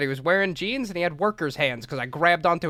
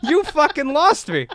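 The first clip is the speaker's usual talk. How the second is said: higher, louder retold protest; indignant outburst; 8 semitones higher, 4 dB louder than usual. Kid to the mom who lost him, indignant outburst. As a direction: act out an outraged kid snapping back. Child angrily answers mother back.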